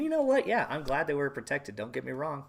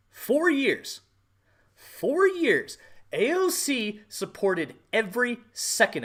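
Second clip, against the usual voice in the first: higher and louder, hammering each repeat; angry shout; 10 semitones higher, 7 dB louder, slower than usual. Real, not acted. Vlogger furious over four years of riots, shouting. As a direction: yell it out in outrage.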